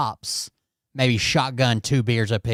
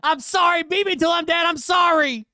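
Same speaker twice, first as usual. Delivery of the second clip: mock pleading yell. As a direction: ham up her begging as a high shrieking yell. Man mimics woman's pleading cries.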